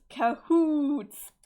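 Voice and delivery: CAHOOTS yelled out loud; shouted word. Acted.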